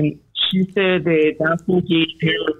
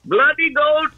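put-on Indian accent; 8 semitones higher than usual. Prank caller in Indian accent.

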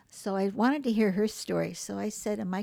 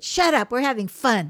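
defiant shout; 3 semitones higher, 11 dB louder than usual. Retold or imitated. Mother shouting the psychologist down, defiant yell. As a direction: snap back, gleefully defiant.

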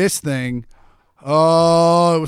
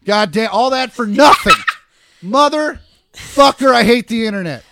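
louder, higher, cursing through laughter; laughing fury; 5 semitones higher, 6 dB louder than usual. Real, not acted. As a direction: explode at the spoiler, half laughing. Puzzle answer spoiled online.

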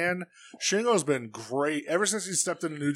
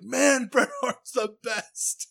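excited shout; 9 semitones higher, much slower than usual. Retold or imitated. Recalls popping with excitement.

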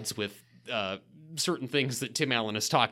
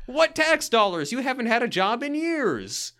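mock retold retort; 9 semitones higher than usual. Quoting angry family in recap.

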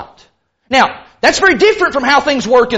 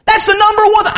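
high, worked-up listing of grievances; lightly acted touchy churchgoer; overly defensive person; 8 semitones higher, faster than usual. Preacher plays a member counting slights, overly defensive. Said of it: "I'm gonna be the overly defensive person".

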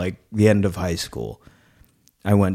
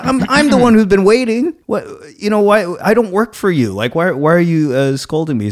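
retold angry protest; 10 semitones higher than usual. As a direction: push back at the staff, pitched up and heated. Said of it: "I got so mad".

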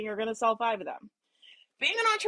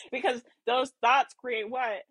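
normal talking voice

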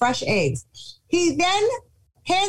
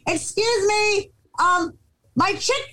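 put-on polite call to kids; 8 semitones higher than usual.

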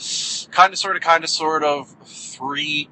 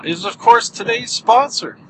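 ordinary talk, nothing marked

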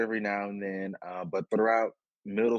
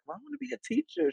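higher quoted voice; much faster than usual. Retold or imitated. Her younger self quoted.

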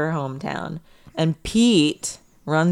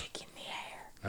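whispering; 24 dB quieter than usual. Pretend. Whispered echo of tagline.

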